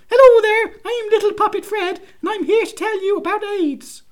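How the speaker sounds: high, loud, chirpy puppet voice; squeaky hand puppet greeting the room; glove puppet voice. Acted. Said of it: "little puppet Fred could come out and go".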